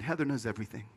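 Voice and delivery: low, hushed bad news from a friend; quiet, pitying delivery, dropping away at the end. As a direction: break it softly and low. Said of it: "with a pitied look on his face".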